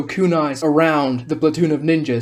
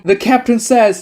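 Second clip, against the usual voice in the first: ordinary delivery, nothing marked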